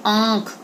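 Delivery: आंख with a light न-like nasal colour; nasal vowel demo. Shown as a demonstration. Teacher shows pupils the nasal sound in आंख. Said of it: "न का उच्चारण हो रहा है ना".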